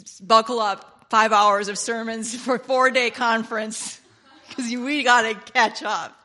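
loud, driving sermon delivery; fervent, booming preacher voice; preaching at full force. Real, not acted. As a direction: preach it hard to the room.

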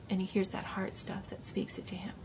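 hushed preacher's whisper. Real, not acted. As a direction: drop to an intimate whisper. Preacher drops into intimate close.

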